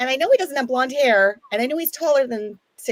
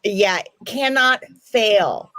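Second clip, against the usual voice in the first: slow; much slower than usual. Acted. Slow repeated sales pitch.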